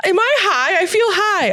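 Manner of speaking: very high, giggly squeal; real giddy, high-pitched outburst; giddy squeak. Real, not acted. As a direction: squeak it out on the edge of hysterics. Giddy, can't stop laughing.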